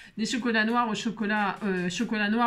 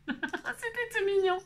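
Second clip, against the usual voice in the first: cooing; 9 semitones higher, 4 dB quieter, slower than usual. Real, not acted. Cooing over cute mushroom die cut.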